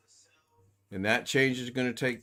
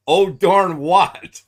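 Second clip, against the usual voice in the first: loud, higher, drawn-out question; loud teasing echo; 7 semitones higher, 13 dB louder, much slower than usual. Real, not acted. Host pouncing on a friend's "oh, darn", loud and drawn out. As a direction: stretch each word out loudly.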